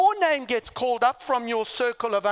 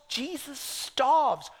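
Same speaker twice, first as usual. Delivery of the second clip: usual speaking voice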